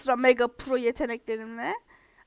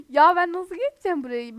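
frustrated outburst; 5 semitones higher, 10 dB louder than usual. Real, not acted. Frustrated at hard level.

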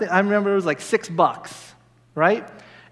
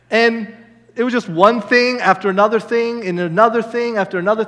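ordinary talk

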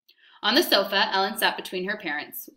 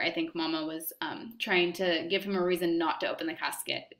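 usual speaking voice

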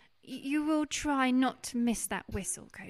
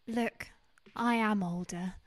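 ordinary talk

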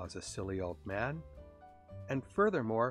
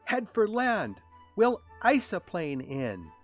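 loud call, high then slower; 9 semitones higher, 8 dB louder, slower than usual. A narrator's reading. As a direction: holler instructions to someone overhead. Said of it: "called up to the sleigh".

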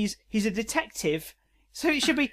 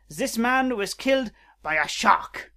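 put-on slow, dramatic French-accented verdict; hammy French detective, slowly building; 2 semitones higher than usual. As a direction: draw out the reveal in mock French. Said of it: "Robo Kitty has a French accent".

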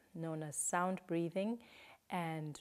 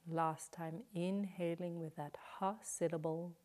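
guided breathing cues. Shown as a demonstration. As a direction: lead the breath gently, leaving room to breathe. Counts breaths for the class.

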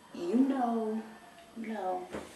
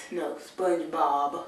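ordinary delivery

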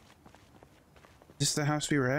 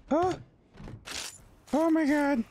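startled exclamation; 13 semitones higher than usual. Real, not acted. Sudden scare in game.

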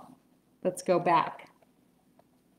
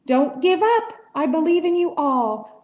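higher, louder encouraging call; 6 semitones higher, 6 dB louder than usual. A narrator's reading. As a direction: call out to the mayor. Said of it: "he managed to call to the mayor".

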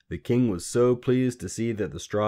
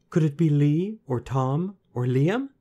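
put-on high, louder questioning girl; feigned guessing; 8 semitones higher, 6 dB louder than usual. A narrator's reading. Queen pretending to guess for the little man, questioning. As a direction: offer each name like an innocent guess.

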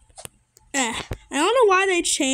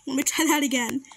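usual speaking voice

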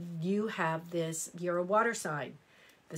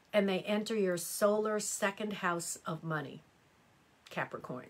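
normal talking voice

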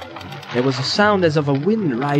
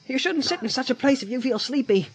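Sam voice; 9 semitones higher, 4 dB quieter than usual. A narrator's reading. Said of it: "said Sam".

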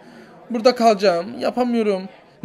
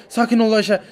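ordinary talk, nothing marked

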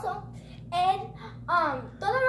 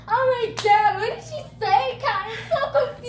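loud, high, frantic confusion; hammed-up baffled wail; confused mom; 6 semitones higher, 7 dB louder than usual. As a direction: imitate a bewildered mom begging for help. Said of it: "Mommy was all like".

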